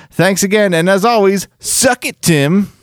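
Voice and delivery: reading aloud. Acted. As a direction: read the sign-off up high, building to the jab.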